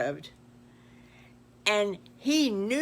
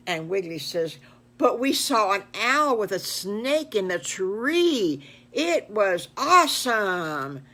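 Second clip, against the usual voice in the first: put-on louder, slow boasting kid; excited piglets; 3 semitones higher, 7 dB louder, slower than usual. Piglets telling the paddleman about their trip, loud and slow. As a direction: brag about the animals you saw.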